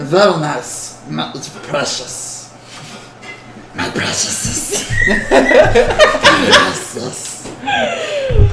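drawn-out whispery creature obsession; Gollum. Acted. Goblin fondling his cloth, Gollum voice. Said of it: "I should do Gollum voice".

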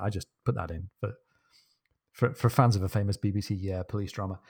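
ordinary talk, nothing marked

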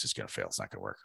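low, soft, scared muttering to a friend; retold fear. Before launch, telling his friend he's scared, hushed and low. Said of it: "I'm scared".

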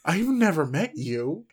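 loud, high, drawn-out disbelief; incredulous retort. Retold or imitated. Woman retelling her reply to a creepy admirer, incredulous.

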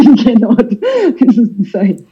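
laughing, high and incredulous; laughing disbelief. Real, not acted. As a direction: laugh at how absurd it is. Laughing disbelief at healthcare costs.

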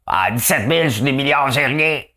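voix de Showtime imitée, un peu plus aiguë et plus forte; imitation de Showtime. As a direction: imiter Showtime en exagérant un brin. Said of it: "je parle comme Showtime". (Showtime, voix imitée avec entrain)

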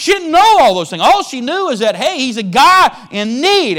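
loud, high, fervent preaching; preaching at full force. Acted. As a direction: preach it out with fire.

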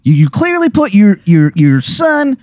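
high-pitched, earnest Baltimore character voice; bad Baltimore accent. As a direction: make a heartfelt speech in broad, high Baltimore. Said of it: "this Baltimore accent".

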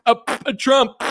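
high, loud dopey chant; mock rally chant; mock cheering fan. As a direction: ham up a brainless superfan. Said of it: "That's like".

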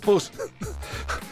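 ordinary talk, nothing marked

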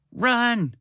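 high-pitched cry of alarm; shouted warning. A narrator's reading. Spaceship warning the group of the squark, shouting. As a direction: call out a high, urgent warning. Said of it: "Spaceship shouted".

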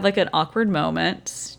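ordinary delivery

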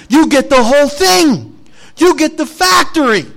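high, loud burst of joy; hammed-up thrilled Wonka proclaiming victory; excited announcement. Wonka turning round to tell Charlie he won, excited and loud.

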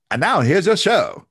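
high, loud 1940s announcer; 1940s newsreel voice. Shown as a demonstration. Podcast host demoing his news segment sign-off, 1940s announcer voice. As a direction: punch it out like a forties newsreel host. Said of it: "in that nineteen forties voice".

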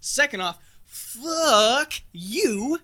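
loud, high, slow outraged yell; furious outburst. Acted. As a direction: explode in offended fury. Offended centaur rages.

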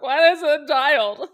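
loud, high imitated wail; mock crying. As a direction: wail it out like an overwhelmed participant, hammed-up. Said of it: "doing it and crying".

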